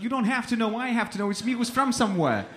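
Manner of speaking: high, loud mock-exasperated insistence; exasperated protest. Man insisting he knows his own origins, exasperated retelling. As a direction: argue it hard, pitched right up. Retells arguing about where he's from.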